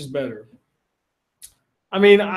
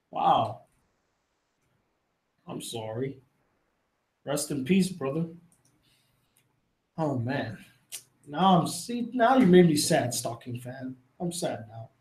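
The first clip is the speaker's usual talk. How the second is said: much softer, lower, halting sorrow; soft sad condolence; 4 semitones lower, 6 dB quieter than usual. Real, not acted. Mourning a dead subscriber to chat, softly sad. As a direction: let the grief sink the voice low and quiet.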